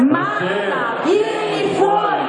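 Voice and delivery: high, drawn-out ringing shout; shouted group call. Shown as a demonstration. Preacher leads calling someone by name, shouted. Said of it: "Lo facciamo?".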